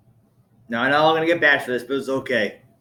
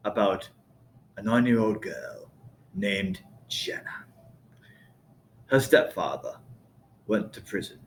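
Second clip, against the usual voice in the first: Sebastian Gorka; 4 dB quieter than usual. Acted. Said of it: "So Sebastian goes".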